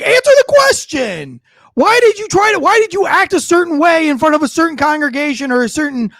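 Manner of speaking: loud, high-pitched outburst; exasperated yelling; angry demand. Real, not acted. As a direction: fire heated questions at an evasive politician. Heated demands at evasive politician.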